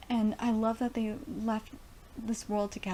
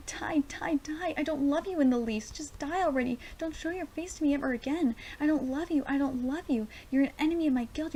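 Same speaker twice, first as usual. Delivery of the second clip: higher-pitched, pleading outburst; impassioned dramatic reading, pitched up; anguished manga character; 6 semitones higher than usual. Acted. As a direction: act out desperate hate that is really love.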